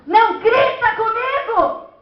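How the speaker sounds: loud, high, drawn-out scolding shout; parent yelling. Acted. Parent shouting at a child not to shout, loud and high. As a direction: yell it at full height, slowly. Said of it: "Não grite comigo nessa altura".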